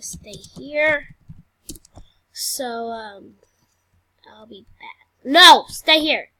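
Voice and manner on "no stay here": loud, slow command; loud slow insistence; firm order. Acted. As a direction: order her to stay put. Ordering patient.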